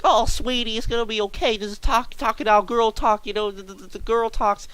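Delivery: high-pitched, gushing comforting imitation; soothing coworker. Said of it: "try to calm her down".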